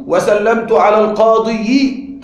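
القاضي slowly with a sounded -yi, al-qāḍiyi; heavy kasra. Shown as a demonstration. Teacher showing the difficulty, القاضي as al-qāḍiyi. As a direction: add a drawn-out "yi" to القاضي. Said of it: "تشعر بصعوبة في النطق".

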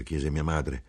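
ordinary delivery

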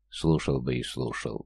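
soft, slow and low, lost in a daydream; dreamy. A narrator's reading. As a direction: sigh it out dreamily, savoring it. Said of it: "мечтательно сказал".

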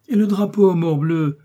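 high, loud cry of morbleu; indignant exclamation. A narrator's reading. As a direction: burst out, outraged to leave the flag. Athos indignant about the flag.

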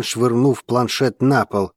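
plain reading voice

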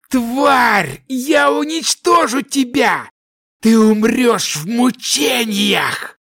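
high, slow furious roaring shout; mad roar. A narrator's reading. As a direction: roar out your hatred. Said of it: "безумно зарычал он".

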